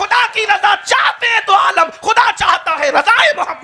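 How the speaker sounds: high, loud, laughing sermon cry; fiery preaching. Acted. Preacher rousing the gathering with soaring praise, loud. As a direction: rouse the crowd at full force. Crowd answering, preacher shouts.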